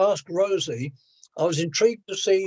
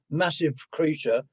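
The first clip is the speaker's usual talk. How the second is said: ordinary talk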